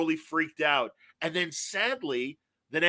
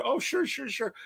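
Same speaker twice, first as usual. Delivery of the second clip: put-on old man's voice; 9 semitones higher, 4 dB quieter than usual. Old writer voiced in story.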